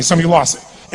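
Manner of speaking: ordinary talk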